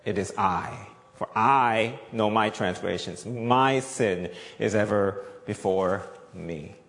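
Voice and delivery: slow, low, humble admission; sincere confession. Retold or imitated. Preacher voicing David's humble confession, slow and low. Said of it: "he says".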